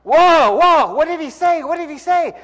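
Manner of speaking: frantic, high, loud cries near the cliff edge; panicked cowboy. Retold or imitated. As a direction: yell in panic, searching for the word.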